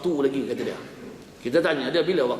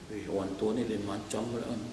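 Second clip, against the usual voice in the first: softer, lower muttered thought; inner aside; 6 semitones lower, 10 dB quieter than usual. Acted. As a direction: say it under your breath. Ustaz's inner thought about the man.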